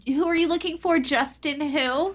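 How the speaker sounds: high puzzled imitation, slowing at the end; confused drive-thru worker. Guest imitates worker mishearing ranch as Justin, baffled. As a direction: ask in baffled, high-pitched disbelief.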